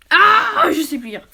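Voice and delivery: a loud high "Ah" breaking into laughter; laugh-shout at her own mistake; laughing exasperation. Real, not acted. Fumbling the dragon book again, laughing exasperated shout.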